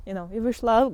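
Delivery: normal talking voice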